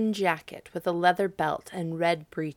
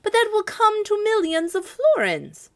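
shocked cry; 14 semitones higher, 8 dB louder than usual. A narrator's reading. Citizens aghast at the piper's price, crying out high. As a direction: gasp it out in disbelief. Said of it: "cried the citizens".

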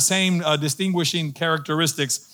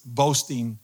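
normal talking voice

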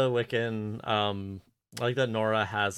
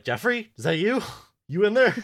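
high loud call; mock calling out; 10 semitones higher, 7 dB louder than usual. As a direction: call into him loudly. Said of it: "they're like".

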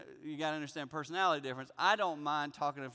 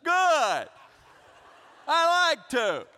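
loud, high, drawn-out mock defiance; angry; 10 semitones higher, 10 dB louder, much slower than usual. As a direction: throw it back at the wife, hammed-up. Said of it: "I'm angry".

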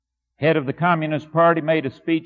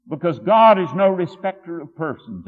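ordinary talk, nothing marked

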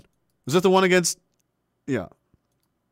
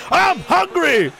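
hungry monster voice; 11 semitones higher, slower than usual. Pretend. Voicing the hungry team.

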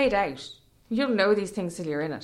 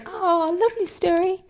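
high, sweet coo; hammed-up tender admiration; mock gushing; 8 semitones higher than usual. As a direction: coo it adoringly. Retelling their gushing reaction.

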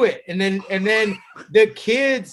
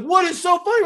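high mock teacher shout; annoyed teacher; 10 semitones higher than usual. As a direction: demand it like an exasperated teacher. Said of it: "the teacher kept being like".

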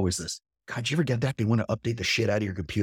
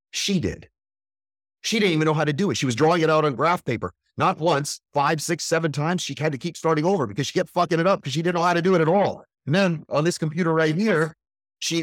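excited bragging; 7 semitones higher, 4 dB louder than usual. Real, not acted. Excitedly bragging about wife's work.

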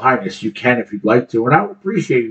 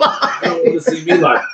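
echoed through a chuckle; laughing; 5 semitones higher than usual. Real, not acted. Echoing his friend's joke about watching himself, laughing. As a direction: laugh while you say it.

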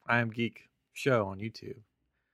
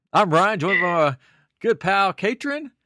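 normal talking voice

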